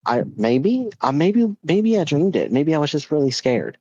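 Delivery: high, hesitant child imitation; mock-uncertain, pitch swooping up; unsure little boy. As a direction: fumble for an answer like a kid. Son unsure, voiced in story.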